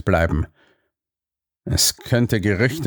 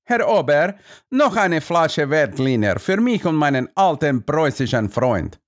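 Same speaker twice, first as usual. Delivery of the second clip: high, lilting call to the waiter; jovial count's high singsong; 5 semitones higher than usual. A narrator's reading. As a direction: call for more wine in a singing lilt. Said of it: "wandte sich der Graf an mich und rief".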